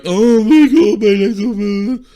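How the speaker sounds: high, loud wail; hammed-up crybaby moan, trailing into blubber; mock crying. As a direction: imitate a silly crying video. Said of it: "posting silly crying videos".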